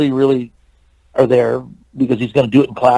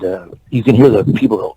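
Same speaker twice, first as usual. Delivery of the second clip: ordinary talk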